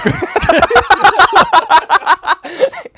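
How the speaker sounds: long, high, loud cackling fit; real laughter. Real, not acted. Bandmate picturing himself screaming in a cape, laughing hard. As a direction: crack up and keep laughing. Cracks up over cape story.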